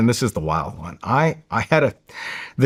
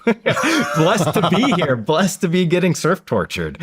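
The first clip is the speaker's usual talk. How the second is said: laughing through it; 6 semitones higher than usual. Real, not acted. Laughing sarcastic gratitude.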